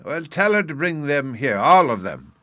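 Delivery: high, loud order from the next room; booming command. A narrator's reading. The other professor orders Sylvie to bring all the boys, loudly. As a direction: call it out through the door.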